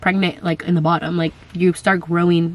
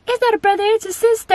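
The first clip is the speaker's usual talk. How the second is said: put-on much higher little girl voice insisting; imitating her daughter; 14 semitones higher, faster than usual. Mom imitating daughter Camila arguing with her brother, squeaky. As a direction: correct him like a stubborn little girl. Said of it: "And then Camila's like".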